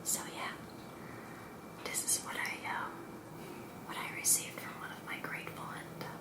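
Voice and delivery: hushed, unvoiced-breath whisper to the viewer; ASMR whisper. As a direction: whisper it softly, close and intimate. Whispered first part of video.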